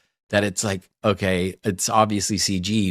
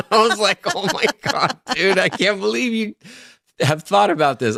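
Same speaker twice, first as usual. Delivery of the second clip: high, laughing exclamation; laughing disbelief; 11 semitones higher, 4 dB louder than usual. Retold or imitated. Co-worker amazed at friend's ranking, laughing disbelief. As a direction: burst out incredulous through laughter.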